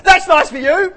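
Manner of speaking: bright mock interruption, shrilled out; loud cheery cut-off. Mimicking the churchgoer who cuts people off, loud and chirpy. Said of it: "And you'd go".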